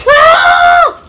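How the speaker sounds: sudden high yelp of fright; startled scream. Real, not acted. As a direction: cry out as you lose control. Failed trick mid-air.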